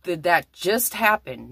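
slow into disbelief after the first outburst; stunned disbelief. Retold or imitated. Siblings reacting to a paranormal event, stunned disbelief.

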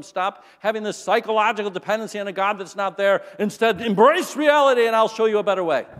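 mock the students' faith from the lectern; preaching professor. Mocking atheist professor quoted.

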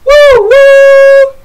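high loud woo woo; teacher hooting the train again for the class; train whistle. Shown as a demonstration. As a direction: hoot like a steam train whistle. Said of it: "You do it for me".

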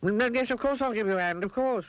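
high, panicky agreement; flustered cry. A narrator's reading. Mister Mean agreeing to chop the wood, flustered. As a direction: backtrack frantically in a high voice. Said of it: "he, he cried".

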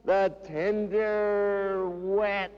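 high, impassioned cries, easing down at the end; theatrical high-pitched recital; anguished poetry declamation. Acted. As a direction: cry it out high, then slow and sink. Dramatic quoted outburst within poem.

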